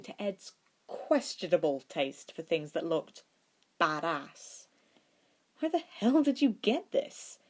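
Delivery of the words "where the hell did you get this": higher, amazed question; genuinely wowed by the ring; curious. A narrator's reading. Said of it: "Ed asked curiously".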